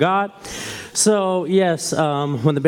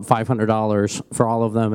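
ordinary talk

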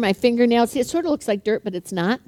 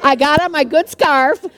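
ordinary delivery

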